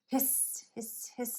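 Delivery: soft, voiceless hisses; snake hissing. A narrator's reading. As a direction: hiss like a storybook snake. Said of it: "said the snakes".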